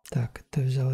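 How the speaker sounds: soft whisper. Real, not acted. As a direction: whisper while grabbing loot. Whispered looting.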